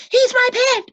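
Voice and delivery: put-on high, loud boyish voice; boy calming the coach. A narrator's reading. Boy defending his pet, high and loud. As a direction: explain it away eagerly. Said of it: "said Lois".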